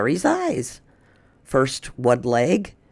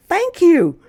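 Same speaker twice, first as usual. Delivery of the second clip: high, loud joyful call; barking thanks; 7 dB louder than usual. Acted. Dog calling thanks to the bird, joyful and loud. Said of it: "He ran down the street barking".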